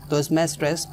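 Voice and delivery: usual speaking voice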